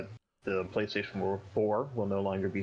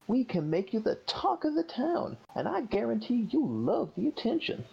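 hype man voice; 11 semitones higher than usual. Acted. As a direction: gush like a flashy hype man. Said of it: "the Hype Man".

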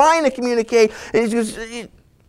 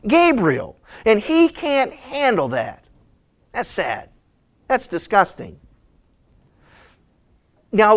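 ordinary delivery

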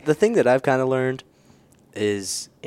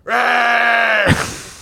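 loud high roar held out; lion roar; 13 semitones higher, 6 dB louder than usual. Pretend. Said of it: "your best lion roar?".

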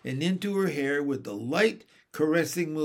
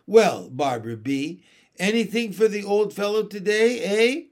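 higher, coaxing voice, not soft; wheedling; 5 semitones higher, 7 dB louder than usual. A narrator's reading. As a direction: sweet-talk her for a treat. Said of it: "in a soft, wheedling voice".

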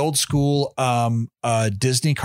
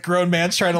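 ordinary talk, nothing marked

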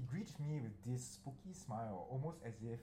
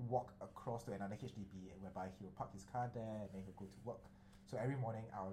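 usual speaking voice

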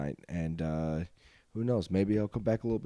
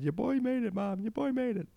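much higher, proud and excited; proud boast. Acted. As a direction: crow about it to your mom.

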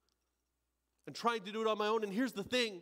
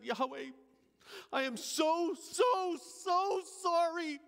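high, anguished plea, swelling to a cry on the middle so; 10 semitones higher, 3 dB louder, much slower than usual. Acted. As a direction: beg forgiveness, voice rising and breaking loose. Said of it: "I cried out in my heart".

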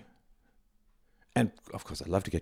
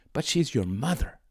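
higher and slowed, mother pressed; imitating someone leaning on mother; mock stress on mother; 7 semitones higher than usual. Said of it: "emphasis, mother".

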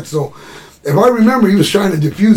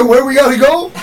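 higher, louder, rising eager question; eager repeat; 9 semitones higher, 5 dB louder than usual. Retold or imitated. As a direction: ask it ready to go right now. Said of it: "That's what he said".

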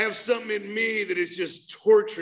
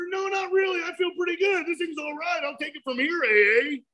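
high mock self-assurance; 9 semitones higher than usual. Mocking his past self-assurance.

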